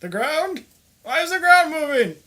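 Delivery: put-on confused old geezer losing his footing; old man voice. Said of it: "I better have an old guy voice".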